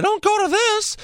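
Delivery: very high falsetto demo; lost-voice squeak. Shown as a demonstration. Teasing sick co-host about his voice, squeaky falsetto. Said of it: "I just talk like this".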